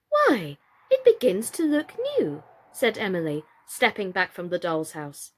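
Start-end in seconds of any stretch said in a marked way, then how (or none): 0.1-2.4 put-on high, lively young voice; delighted girl. A narrator's reading. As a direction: brighten up at the sight. Emily's delighted remark.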